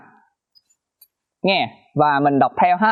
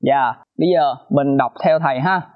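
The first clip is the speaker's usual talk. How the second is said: ordinary delivery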